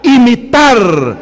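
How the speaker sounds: preaching with rising fervour; fervent preaching. Acted. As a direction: proclaim each line to the congregation. Preaching at full force.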